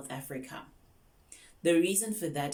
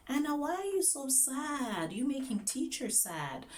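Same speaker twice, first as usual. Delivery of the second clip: sympathetic teacher; 10 semitones higher than usual. Acted. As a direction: coo over an unhappy young student. Teacher consoling a sad student.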